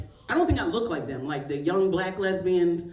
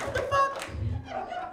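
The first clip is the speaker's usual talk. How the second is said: much higher, louder, frantic protest; high panicked voice getting louder; panicked squeal; 6 dB louder than usual. Retold or imitated. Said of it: "I'm like".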